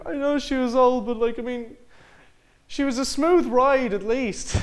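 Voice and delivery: squeaky mock-innocent voice, drawing out the last line; deadpan joke delivery. Grandson at the funeral jokes about the bike, in a high slow put-on voice. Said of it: "he says, like".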